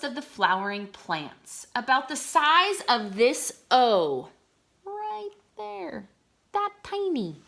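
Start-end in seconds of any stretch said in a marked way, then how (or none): 4.8-7.4 much higher squeaky voice for something tiny; squeaky voice of amazement; tiny cute voice. Acted. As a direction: make it sound teeny. Pointing out tiny plant.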